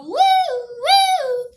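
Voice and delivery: high singsong woo. Pretend. Kid on porch mimicking her yo-yo, high singsong. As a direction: mimic the yo-yo spinning. Yo-yo sound effect.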